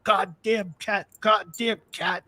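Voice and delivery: imitating a raging dad, slow and high; mock berserk father. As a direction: stretch each curse out like a man losing it. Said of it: "just went crazy".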